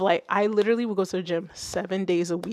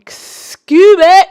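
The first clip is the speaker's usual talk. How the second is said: high, loud excited holler; calling out; 11 semitones higher, 18 dB louder than usual. Real, not acted. Streamer hollers to Scooby, loud high call. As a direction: sing out the name in a high shout.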